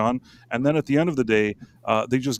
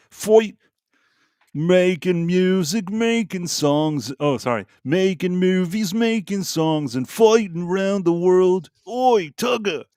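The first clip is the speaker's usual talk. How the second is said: higher, slow sing-song Australian voice; Russell Crowe impression; 6 semitones higher, slower than usual. Host imitating South Park's Russell Crowe in a slow sing-song Aussie voice. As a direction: croon it like a cartoon Aussie hero. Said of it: "South Park episode with Russell Crowe".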